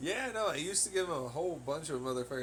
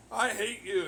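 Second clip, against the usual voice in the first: higher, louder mock "I hate you"; mock outburst; 7 semitones higher, 7 dB louder than usual. Mocking his mother's hatred, a higher louder outburst.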